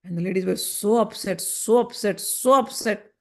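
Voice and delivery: normal talking voice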